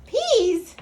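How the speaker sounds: high, loud, puzzled outburst; exclaiming. A narrator's reading. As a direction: blurt it out in comic disbelief. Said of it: "exclaimed Scapito".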